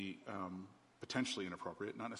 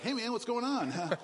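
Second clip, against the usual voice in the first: laughing fake casual; 10 dB louder, slower than usual. Fake-casual 3 a.m. phone call.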